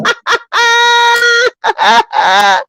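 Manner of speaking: a high, bursting laugh. Real, not acted. Host laughing hard at the clip, high laugh.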